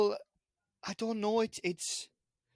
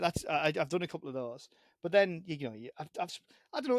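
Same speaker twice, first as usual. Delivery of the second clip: ordinary talk, nothing marked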